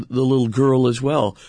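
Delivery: usual speaking voice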